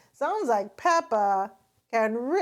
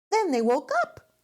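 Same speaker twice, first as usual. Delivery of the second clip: excited cry; 9 semitones higher, 5 dB louder, faster than usual. A narrator's reading. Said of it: "cries Peppa".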